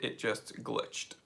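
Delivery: whispering about the glitch to yourself; whispered aside. Real, not acted. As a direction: mutter it under your breath.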